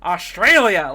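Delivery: loud, punchy mock proclamation; imitated exclamation. Imitating Lex Luthor in the Oval Office, loud exclamation. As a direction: belt out "Australia!" as a movie quote.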